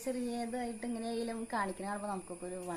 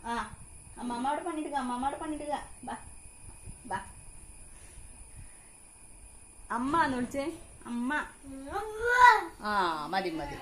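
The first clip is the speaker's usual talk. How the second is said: high sing-song coaxing of a little one; baby talk; 7 semitones higher, 8 dB louder than usual. Acted. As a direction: coax the baby, high and bright. Mother prompting baby to call. (കുഞ്ഞിനോട് കൊഞ്ചി സംസാരിക്കുന്നു)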